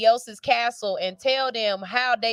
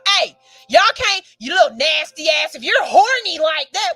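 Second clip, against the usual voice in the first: mock yell; 4 semitones higher, 6 dB louder than usual. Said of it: "talking about".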